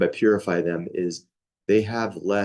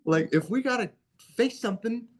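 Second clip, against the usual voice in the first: high, half-joking call-out to a brother who might bolt; hammed-up high voice daring brother not to run; mock challenge to brother; 14 semitones higher than usual. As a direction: tease him, pitched way up. Retold challenge to brother on walk.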